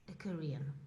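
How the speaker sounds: soft unvoiced whisper. Real, not acted. Speaker admitting she isn't Korean, whispered. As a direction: breathe it out like a secret. Dramatic confession of identity.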